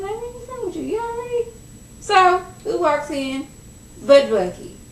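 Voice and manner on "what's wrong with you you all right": high, puzzled squeak; confused reply. Retold or imitated. Scrappy baffled by the riddles, high confused squeak. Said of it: "And he was like".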